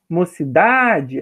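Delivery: "Mocidade" slowly, with DA held long; stress on DA. Shown as a demonstration. Teacher repeating the example for students, "Mocidade" slow with stressed DA. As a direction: stretch the strong DA in "Mocidade". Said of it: "a sílaba mais forte é o da".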